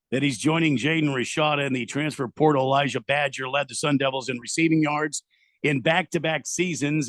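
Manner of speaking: breaking-news bulletin delivery; news read. Acted. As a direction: read it out like a radio newscaster. Reads out a breaking news text.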